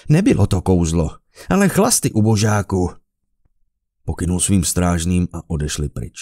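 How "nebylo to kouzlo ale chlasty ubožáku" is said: loud, mocking rebuke; louder contemptuous retort; scornful dismissal. A narrator's reading. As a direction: sneer down at him. Emperor dismisses Carlos.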